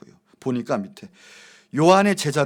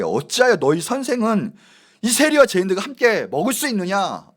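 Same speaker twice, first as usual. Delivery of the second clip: acted indignant challenge, raised and louder; mock seething Pharisees; 6 semitones higher, 8 dB louder than usual. Pharisees challenging the disciples over eating with sinners, mock indignation. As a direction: play an outraged accuser lightly. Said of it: "부글부글 끓어요".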